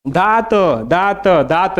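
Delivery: दात drawn out slowly, with the final त sounded out as a separate "ta"; त पर ज़ोर. Shown as a demonstration. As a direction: stretch दात and release its last त clearly so it can be heard. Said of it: "देखिए, त इसमें आ रहा है कि नहीं?". (दात को धीरे-धीरे खींचकर, आख़िरी त अलग से सुनाते हुए)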